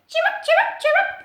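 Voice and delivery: very high chirping insect; chirping grasshopper. Acted. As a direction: chirrup like a grasshopper. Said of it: "he said".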